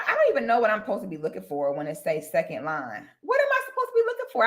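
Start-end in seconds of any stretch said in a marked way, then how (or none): 3.2-4.4 fast, high-pitched confused question; squeaky, hurried bewilderment; baffled. Real, not acted. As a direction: rattle it out high, totally lost. Baffled by search results.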